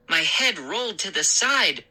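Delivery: ordinary delivery, nothing marked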